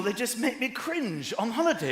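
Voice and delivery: ordinary talk, nothing marked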